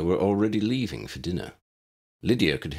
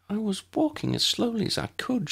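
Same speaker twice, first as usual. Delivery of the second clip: musing; 14 semitones higher than usual. A narrator's reading. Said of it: "she mused".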